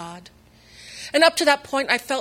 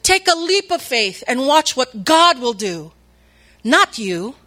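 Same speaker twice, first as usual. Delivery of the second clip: loud, slow, preacher's boom; booming pastor; 4 semitones higher, 9 dB louder, slower than usual. Retold or imitated. Pastor calling from the pulpit to the congregation, loud and slow. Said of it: "it boomed really loud".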